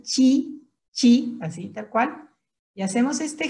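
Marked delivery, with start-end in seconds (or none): 0.0-1.4 chi with a CH sound, loud and distinct; pronunciation demo. Shown as a demonstration. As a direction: model chi as if spelt C H. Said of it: "se pronuncia como si fuera C H". ("chi" pronunciado con sonido de CH)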